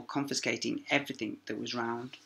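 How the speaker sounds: usual speaking voice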